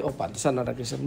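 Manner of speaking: quiet disparaging aside; knowing disapproval. Shown as a demonstration. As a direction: say it softly, as if it explains everything bad. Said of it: "jangan sampai orang kayak gitu". (sindiran pelan meremehkan)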